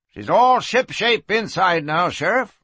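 lightly voiced old turnkey; a hint of a cheerful old man; old man announcing. A narrator's reading. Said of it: "he announced".

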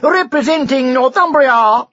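high, loud ringside announcer; royal herald. A narrator's reading. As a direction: announce like a boxing MC. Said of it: "shouted the herald".

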